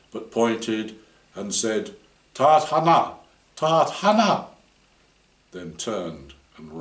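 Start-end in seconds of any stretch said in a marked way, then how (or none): 2.4-4.5 put-on urgent child's cry. Frightened boy points and shouts.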